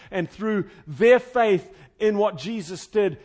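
loud, weighty proclamation, slowing towards the end; impassioned preaching. Real, not acted. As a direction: proclaim it with force to the congregation. Preaches at full force.

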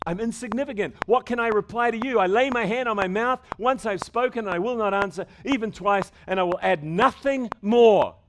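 loud, high preaching of Job's reply, slowing on the last line; preaching at full force. Acted. Pastor voicing Job's answer to God for the congregation, preaching loud. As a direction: proclaim it like a broken man's vow, full voice.

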